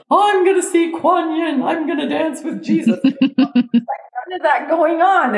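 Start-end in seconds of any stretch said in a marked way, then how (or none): 0.1-3.0 high, starry-eyed mock spiritual fantasy; lightly sent-up spiritual enthusiasm; mock blissed-out seeker. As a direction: gush it like a dreamy seeker expecting visions. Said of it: "'Cause you didn't have any ideas like".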